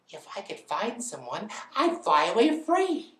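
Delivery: high, complaining bird voice; bored lazy bird. Acted. Lazy bird tired of hatching her egg, high complaint. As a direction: whine theatrically in a high bird voice.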